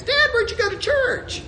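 high-pitched kid's question, put on; small child. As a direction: pipe up like an eager little kid asking Dad. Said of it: "the first thing they're gonna ask".

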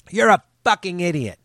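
quoted insult. Retold or imitated. Reading the girl's quote.

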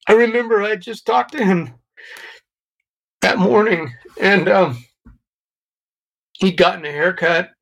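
fighting back tears as he speaks; voice breaking. Real, not acted. Recalling father's death, long pause.

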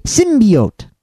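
"Symbiote" with a clear EE, SIM-bee-oat; stress the E. Shown as a demonstration. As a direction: demonstrate "Symbiote" with BEE in the middle. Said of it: "the key thing here is the E".

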